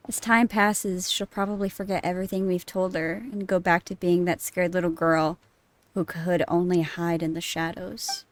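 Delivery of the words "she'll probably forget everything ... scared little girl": ordinary delivery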